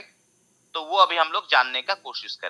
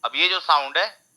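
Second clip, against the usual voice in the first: ordinary delivery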